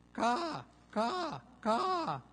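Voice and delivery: high, loud, stretched gull caws; high-pitched imitated seagull cries; long seagull bark. Shown as a demonstration. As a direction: bark like a gull, holding each call. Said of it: "a longer form of the bark".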